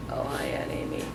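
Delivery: small sheepish whisper, caught out; guilty. Retold or imitated. Niece caught being rude to her mother, sheepish whisper. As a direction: whisper it like a kid caught red-handed. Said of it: "immediate guilt in her voice".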